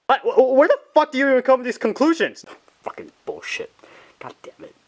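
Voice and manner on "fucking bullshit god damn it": low, muttered curse with the last words breathed out. Acted. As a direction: grumble it under your breath, much softer. Freddy curses under his breath.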